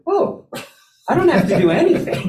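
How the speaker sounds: high, loud burst of relieved realization; laughing relief. Retold or imitated. Stand-in learning someone else gives the talk, laughing with relief.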